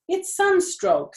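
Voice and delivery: high, slow, loud; urgent. A narrator's reading. Zuckerman declaring the pig has sunstroke. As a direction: announce it urgently.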